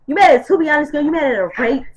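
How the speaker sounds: mock the sender with attitude; sassy repeat. Shown as a demonstration. Reading the old message back with sass.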